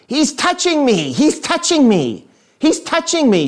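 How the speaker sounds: high-pitched drawn-out tattling wail; whining sister. Retold or imitated. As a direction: imitate a kid sister complaining from the back seat. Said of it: "she would say to my father".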